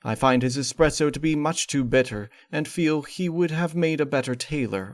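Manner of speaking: usual speaking voice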